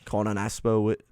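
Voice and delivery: put-on loud, faltering Scottish accent. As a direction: struggle through a mock Scottish host intro. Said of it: "why can't I do it now?".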